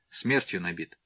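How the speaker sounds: ordinary delivery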